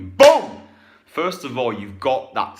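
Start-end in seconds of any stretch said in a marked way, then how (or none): 0.0-0.6 much higher, shouted boom; loud boom. Shown as a demonstration. Coach to viewer, a shouted boom. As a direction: punch the boom out. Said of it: "emphatic exclamation".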